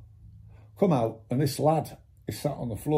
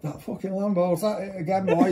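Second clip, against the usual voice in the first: a lightly voiced copper, a bit higher; imitating copper; 5 semitones higher than usual. Copper over the radio about the Lambo, lightly imitated. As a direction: mimic the copper's disbelief, lightly. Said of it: "It's just the way he says it".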